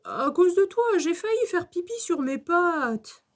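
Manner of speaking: high-pitched whining complaint; Alfred ronchon. A narrator's reading. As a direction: blame a classmate, grumbling in a child's voice. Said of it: "ronchonne Alfred". (plainte ronchonne d'enfant)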